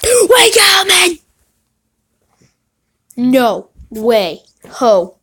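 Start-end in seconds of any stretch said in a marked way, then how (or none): 0.0-1.2 gasping, high and loud wake-up yell; excited shout. Real, not acted. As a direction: burst out at the card. Gasp at a big pull.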